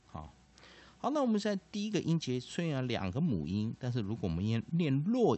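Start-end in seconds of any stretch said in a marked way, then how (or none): none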